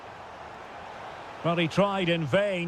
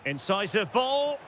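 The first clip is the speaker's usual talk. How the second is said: ordinary talk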